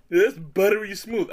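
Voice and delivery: slow, high, loud sales pitch; buttery smooth pitch. Retold or imitated. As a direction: drawl it like a smooth TV ad. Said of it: "that description".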